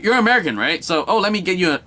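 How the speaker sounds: half-hearted Irish lilt that fades out by the end; Irish accent. Retold or imitated. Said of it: "Sorry about the accent".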